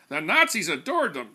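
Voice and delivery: a hint of a grandstanding consultant; pompous PR man. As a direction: lecture it like a self-important pundit. JP lecturing the committee.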